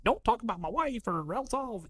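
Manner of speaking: high-pitched hothead threat; mock threat. Husband defending his wife, high mock threat. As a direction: play the overprotective husband. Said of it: "the guy that's like".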